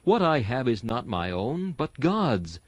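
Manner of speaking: ordinary talk, nothing marked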